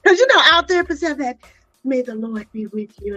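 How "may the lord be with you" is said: a hint of a hushed, gentle counselor; mild soft churchy therapist blessing; soothing therapist voice. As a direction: lightly mimic a calm therapist, much softer. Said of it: "talk with that voice like that".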